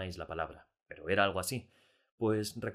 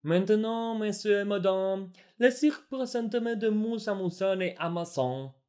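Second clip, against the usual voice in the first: much higher, louder, slow announcer in mock French; fake French ringmaster; 12 semitones higher, 7 dB louder, slower than usual. A narrator's reading. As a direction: announce the circus act at full voice in garbled French. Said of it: "anunciar a voz en cuello".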